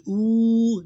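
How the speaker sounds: Oo held long, high and loud; long vowel. Shown as a demonstration. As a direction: stretch Oo into one steady vowel. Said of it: "this is the long sound".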